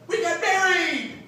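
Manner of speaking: high, loud, slowed gushing news; excited announcement. Retold or imitated. Daughter tells her father she eloped, high excited gush. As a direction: burst out the big news.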